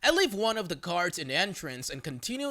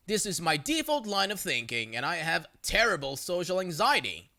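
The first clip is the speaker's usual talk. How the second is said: usual speaking voice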